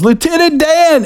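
high call across the water; yelling a name. Retold or imitated. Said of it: "he yells".

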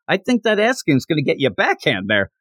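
ordinary delivery

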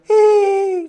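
put-on high squeaky hidden-kid giggle; a quick high giggle from a hiding child; child's giggle. Preacher imitating a hiding child giving herself away with a high giggle. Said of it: "giggle, whisper".

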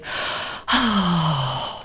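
long falling sigh of defeat; weary sigh. Pretend. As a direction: heave a big discouraged sigh. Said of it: "where you're just feeling".

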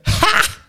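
high, breathy burst of laughter; sharp laugh. Real, not acted. Laughing at his own imagined note, high burst. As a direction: laugh at the line.

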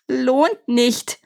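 mock screech, tipping over high; screeching demon. A narrator's reading. Demon protests at the prince's dismissal, screeching. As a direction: voice cracking upward in indignation. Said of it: "kreischte der erste Dämon mit überkippender Stimme".

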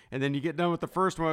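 ordinary talk, nothing marked

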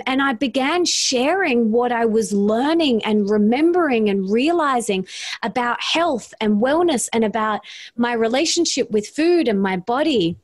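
normal talking voice

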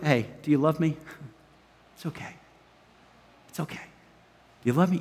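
soft, low and tender, as Jesus; hushed, forgiving voice with long pauses; gentle reassurance. Retold or imitated.

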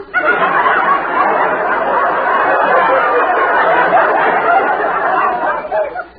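long high giggle; giggling. Acted. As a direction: laugh at your own joke. Oriole laughs at her own joke.

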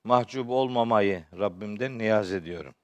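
ordinary delivery

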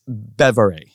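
"Bevere" said slowly and carefully, each syllable clear; name pronunciation. Shown as a demonstration. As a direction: spell out "Bevere" by sound, slowly. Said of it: "And that's the way it's said".

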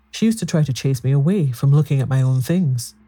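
ordinary delivery, nothing marked

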